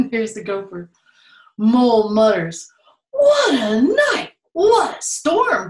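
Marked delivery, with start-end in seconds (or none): 3.1-5.7 twittering wren. Acted. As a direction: chirp it out as the tiny wren. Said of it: "twitters wren".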